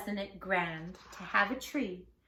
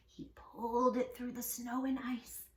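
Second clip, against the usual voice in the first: ordinary delivery, nothing marked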